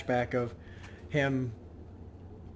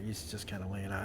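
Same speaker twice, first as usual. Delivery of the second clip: normal talking voice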